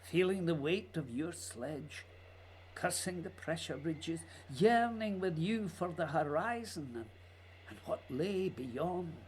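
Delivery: low, hushed, unhurried lines; hushed poetic narration. Acted. Barry speaking softly to Scott across the ice, hushed. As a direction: speak it like a letter to a lost friend.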